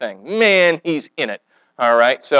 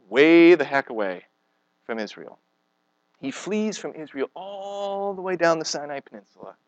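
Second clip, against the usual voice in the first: ordinary delivery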